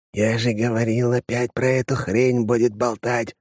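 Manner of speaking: plain reading voice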